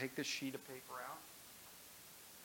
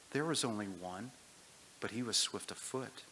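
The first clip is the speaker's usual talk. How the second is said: reading a story aloud to the church; measured storybook reading; story narration; 5 dB louder than usual. A narrator's reading.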